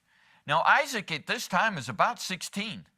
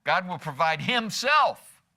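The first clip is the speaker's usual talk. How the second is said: himself raised and punched, slowly; heavy emphasis on himself; stress on HIMSELF; 4 dB louder, slower than usual. Shown as a demonstration. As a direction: drive slowly toward a strong himself. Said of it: "No matter how you emphasize it".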